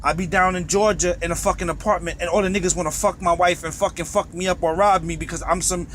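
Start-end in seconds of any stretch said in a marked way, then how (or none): none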